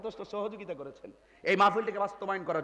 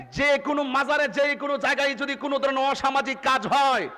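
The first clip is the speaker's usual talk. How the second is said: fiery preaching; 4 semitones higher, 4 dB louder than usual. Real, not acted. Preacher rousing the crowd.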